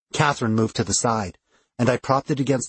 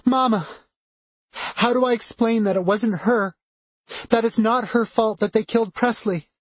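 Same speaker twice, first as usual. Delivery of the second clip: high, anguished pleading; distraught; 11 semitones higher than usual. A narrator's reading. Distraught daughter grieving at the door.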